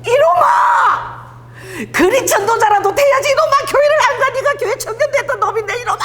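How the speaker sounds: 높고 크게 소리치며 꾸짖는 어머니 흉내; 전화로 아들을 야단치는 권사님처럼 높게 외치기. Retold or imitated. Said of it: "엄마가 전화하면은". (전화로 아들을 꾸짖는 엄마, 높고 크게 외치는 흉내)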